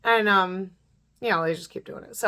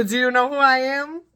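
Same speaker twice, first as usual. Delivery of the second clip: higher, louder, rising challenge; retold comeback; 5 semitones higher, 5 dB louder than usual. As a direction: throw her haughty question back at her. Throws the line back, retold.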